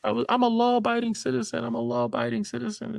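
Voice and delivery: hammed-up high-pitched begging to the officer; mock begging. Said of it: "she just was like".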